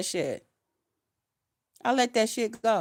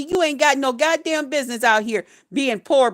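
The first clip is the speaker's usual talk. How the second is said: ordinary talk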